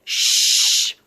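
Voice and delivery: sharp, loud hissing shush; hissed shush. Acted. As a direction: hiss to silence someone mid-sentence. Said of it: "Swift hissed".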